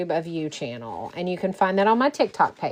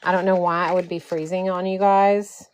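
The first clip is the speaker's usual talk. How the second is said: ordinary talk, nothing marked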